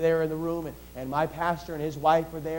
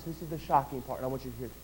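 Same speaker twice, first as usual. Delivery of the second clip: dropped to a hush; 4 semitones lower, 9 dB quieter, faster than usual. Acted. Preacher drops voice for emphasis.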